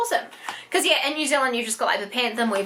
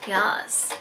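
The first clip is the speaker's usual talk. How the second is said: normal talking voice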